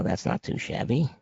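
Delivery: ordinary talk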